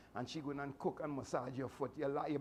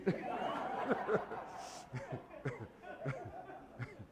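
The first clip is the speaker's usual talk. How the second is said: breathless wheezing laugh; 5 semitones lower, 4 dB quieter than usual. Real, not acted. Laughs at his own joke.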